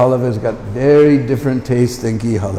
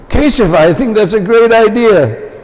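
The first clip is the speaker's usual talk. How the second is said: higher, eager yes; eager agreement; 7 semitones higher than usual. Retold or imitated. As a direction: say it like a kid offered seconds.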